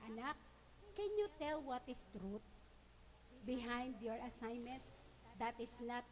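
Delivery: soft, low coaxing; teacher softly asking the child for the truth; gentle teacher. Retold or imitated. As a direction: kneel and ask the child gently. Teacher kneels, gently asks child.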